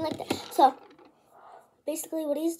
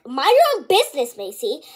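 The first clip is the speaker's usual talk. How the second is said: put-on sharp rebuke at a kid; mock telling-off; 8 dB louder than usual. [name] stepping in against Macy, loud.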